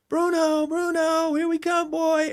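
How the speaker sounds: high, loud call to a dog. Retold or imitated. Owner calling his dog down the hotel hallway, calling out. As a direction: sing out the dog's name down a hallway. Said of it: "I'm calling him".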